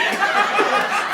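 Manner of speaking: real high cackle at own joke; high laugh. Real, not acted. Comic laughing at his own joke, high cackle.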